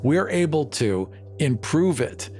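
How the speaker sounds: ordinary talk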